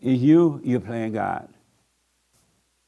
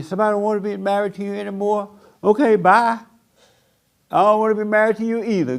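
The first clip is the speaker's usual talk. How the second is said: higher, quick, carefree "okay bye" voice; mock breezy brush-off; 7 semitones higher, faster than usual. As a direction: shrug off the leaving spouse with a light laugh. Mock breezy reply to spouse leaving.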